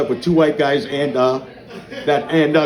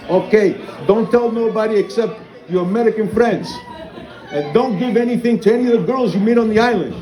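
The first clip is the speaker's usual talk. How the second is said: higher-pitched Greek lady giving a warning; 6 semitones higher than usual. Acted. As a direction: imitate a Greek pharmacist with accented English. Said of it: "the Greek lady's like".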